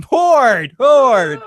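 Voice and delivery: high, loud Boston accent. Shown as a demonstration. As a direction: shout horde in a Boston accent. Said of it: "It was my Boston accent".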